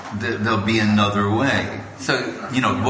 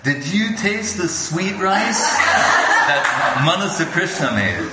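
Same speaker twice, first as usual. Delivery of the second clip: delighted guru; 6 semitones higher than usual. Retold or imitated. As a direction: savour the question with glee. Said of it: "he says".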